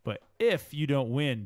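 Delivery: slow read with "if" punched hard; stress on if. Retold or imitated. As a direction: enunciate "if" as if typed in capitals. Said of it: "this is her capitalizing".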